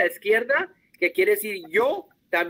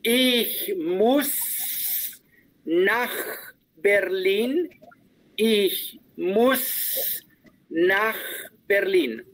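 slow, separated words; slow model phrase; much slower than usual. Shown as a demonstration. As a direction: model it slowly for the student to copy. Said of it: "quiero que trates de arremedar la pronunciación". (frase despacio para que la imiten)

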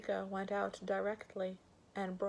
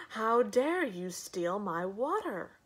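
loud, slow, indignant challenge; outraged cry; 2 semitones higher, 7 dB louder, slower than usual. Acted. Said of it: "cried the head".

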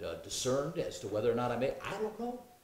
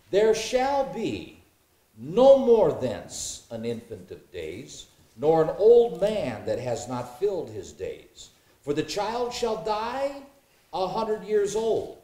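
slow, high and loud, weighing each word; emphatic scripture reading; 5 semitones higher, 7 dB louder, slower than usual. Acted. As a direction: proclaim it like a preacher from the pulpit.

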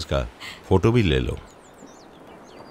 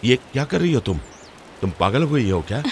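indignant, raised-pitch questioning; upset, higher-pitched scolding; angry confrontation; 6 semitones higher than usual. Acted. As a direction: demand to know who allowed this. Angry confrontation over burnt pages.

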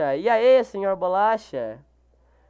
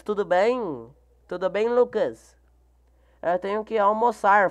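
usual speaking voice

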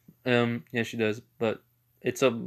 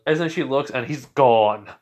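ordinary talk

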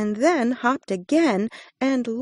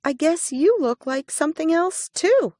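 little hare voice; 8 semitones higher, faster than usual. A narrator's reading.

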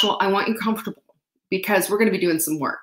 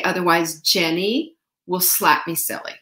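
usual speaking voice

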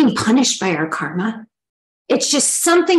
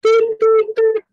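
high-pitched alarm beeps; body beeping; 4 dB louder, slower than usual. Retold or imitated. The body flaring up, beeping an alarm. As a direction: beep like a little warning alarm. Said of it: "it will flare up and say".

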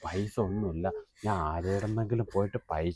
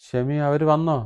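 calling out; 7 semitones higher, 8 dB louder than usual. Acted. Calling out to Shemi.

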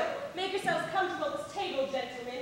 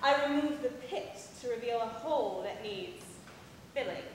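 slow, lingering recipe with double meaning; suggestive innuendo; 4 dB louder, slower than usual. Acted. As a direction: linger suggestively, building in volume towards the squeeze. Suggestive peach recipe before an audience.

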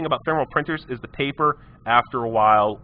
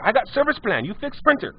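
much higher broken-English customer, insisting; high insistent customer; 11 semitones higher than usual. Retold or imitated. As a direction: squeak out the demands, won't budge. Customer voiced in retold story.

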